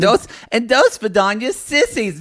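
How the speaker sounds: high, campy Russian farewell; mock Russian accent. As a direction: say goodbye in hammed-up Russian.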